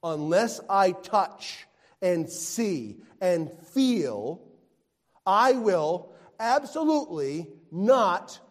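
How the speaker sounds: loud, slow, insistent refusal; Thomas's emphatic vow. Acted. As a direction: spell out each condition. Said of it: "here is Thomas's statement".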